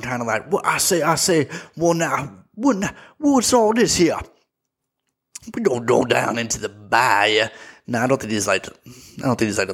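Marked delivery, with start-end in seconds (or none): 0.5-7.5 stammering, repetitive Southern gentleman bluster; Foghorn Leghorn. Acted. Mocking a film detective's Southern accent, Foghorn Leghorn style. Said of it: "a real foghorn leghorn".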